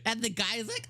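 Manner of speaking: ordinary talk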